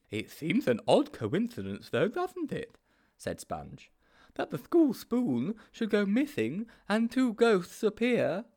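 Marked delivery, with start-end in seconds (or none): 0.1-2.8 high-pitched, naive sidekick; high storybook character voice; Sponge's character voice. A narrator's reading. 4.3-8.4 high-pitched, naive sidekick; high storybook character voice; Sponge's character voice. A narrator's reading.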